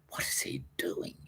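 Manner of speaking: hushed, puzzled whisper. Retold or imitated. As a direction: whisper it like a baffled onlooker. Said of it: "I'm like".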